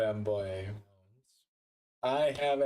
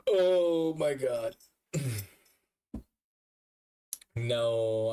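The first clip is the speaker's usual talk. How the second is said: usual speaking voice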